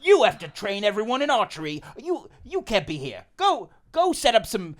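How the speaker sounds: loud barking orders; stern shouting. Acted. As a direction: bark commands at the room. Friar Tom bursts in ordering.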